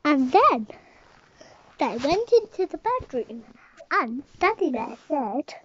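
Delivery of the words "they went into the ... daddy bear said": plain reading voice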